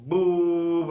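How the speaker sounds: sudden loud shout of boom; mock slam yelled. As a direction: bang it out like a phone slammed down. Retold phone slammed down.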